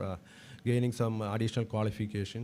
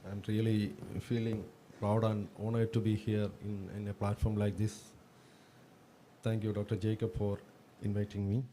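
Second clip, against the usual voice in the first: hoarse, sore throat voice. Real, not acted. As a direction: talk through a sore throat. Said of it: "I have a sore throat".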